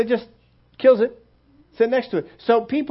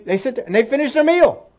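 ordinary talk

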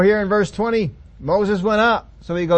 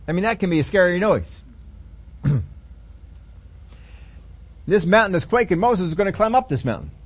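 ordinary talk, nothing marked

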